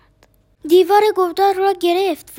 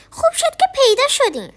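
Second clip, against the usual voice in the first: relieved; 10 semitones higher, faster than usual. A narrator's reading. Relief after being lost.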